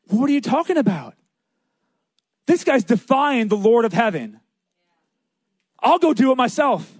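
high, emphatic outraged protest; fired-up incredulous challenger; indignant disbelief. Retold or imitated. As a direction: act out defiant outrage, pitch way up. David outraged, volunteers.

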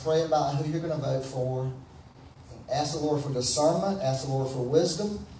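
ordinary talk

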